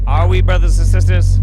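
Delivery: mock preacher. Mock sermon to the chat, calling out loudly. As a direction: rouse the congregation.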